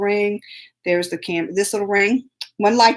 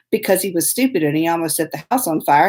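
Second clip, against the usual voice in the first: ordinary delivery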